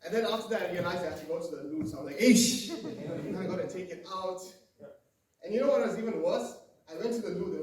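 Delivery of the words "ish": sudden loud, higher outburst; acted exasperated interjection; exclaimed dismay. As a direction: react to an unwelcome realization. Exclaims dismay at needing the loo.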